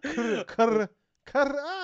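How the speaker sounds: Kurr kurr trying to trill, high; strained attempt to roll the r in kurr. Shown as a demonstration. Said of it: "I can't roll my goddamn R's".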